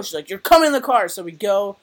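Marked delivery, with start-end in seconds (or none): 0.3-1.1 louder, higher firm command; stern mom order. Retold or imitated. Mom ordering her sulking daughter into the car, loud and firm. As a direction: lay down the law like a mom.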